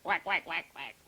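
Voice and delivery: low, short duck quacks; quacking duck. A narrator's reading. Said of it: "said the duck".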